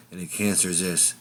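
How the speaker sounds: usual speaking voice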